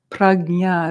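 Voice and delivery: prajna said slowly as "prag-nya", hard g; careful, slow "prag-nya" for prajna. Shown as a demonstration. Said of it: "it's a little more accurate".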